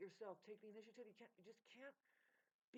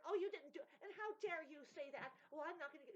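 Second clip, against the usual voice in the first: higher, louder outraged retort; mock indignation; 8 semitones higher, 7 dB louder than usual. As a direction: act out an offended objector. Acts out indignant objectors.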